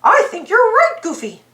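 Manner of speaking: high, kindly cartoon-mouse voice; little hero proposing to help; Mickey Mouse voice. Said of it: "Mickey agreed".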